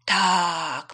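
long, loud, self-satisfied yes; drawn-out boastful hare; proud of himself. A narrator's reading. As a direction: announce it with pride. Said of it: "powiedział dumny z siebie zając".

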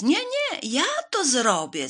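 very high, loud cat cry; high, loud, insistent volunteer; eager shout. A narrator's reading. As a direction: shout over the others as the cat. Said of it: "zawołał biały kot".

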